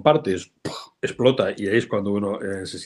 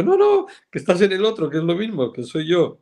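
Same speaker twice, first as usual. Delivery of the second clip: high, louder exasperated explanation; mock exasperated reply; 10 semitones higher, 3 dB louder than usual. Retelling his reply to a pleading member, in a high exasperated voice. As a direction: tell them it's the same group, pitched up and exasperated.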